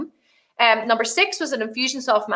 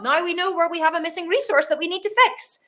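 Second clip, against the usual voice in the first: ordinary talk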